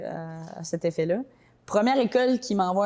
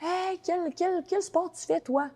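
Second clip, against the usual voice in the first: high, eager stranger's voice; a fan calls out in a bright, raised voice; imitating a curious stranger; 10 semitones higher than usual. Americans see her Boston College shirt, eager high call. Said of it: "les gens ils voient".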